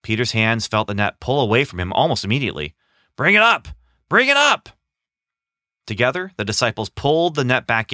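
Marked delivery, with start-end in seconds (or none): 3.2-4.7 high, loud, rising urgent cry; excited shout. Acted. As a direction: yell to the crew to haul. Excited cry as net fills.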